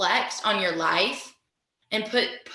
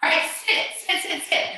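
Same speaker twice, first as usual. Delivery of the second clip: high, urgent call to sit down; 7 semitones higher than usual. Real, not acted. Teacher calls class to sit.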